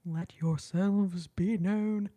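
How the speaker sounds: a hammed-up grand call out to the April babies; mock grand proclamation. Real, not acted. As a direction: let yourselves be known, voiced out loud and slow. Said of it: "sorry, I'm being".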